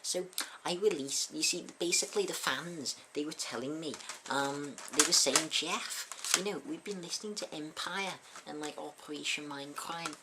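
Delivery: a rough stab at a Scouse rock singer; poor Scouse accent. Acted. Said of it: "such a poor Scouse accent as that".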